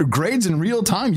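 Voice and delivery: high and slow, hammed-up wonder; mock excitement. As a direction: oversell the marvel. Mock amazement at the idea.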